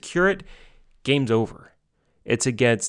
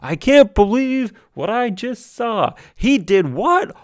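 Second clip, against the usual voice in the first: high, stunned cry of disbelief; father leaping up in astonished excitement; dad's amazed disbelief; 11 semitones higher than usual. Retold or imitated. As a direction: play the reserved dad suddenly losing it. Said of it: "he's just like".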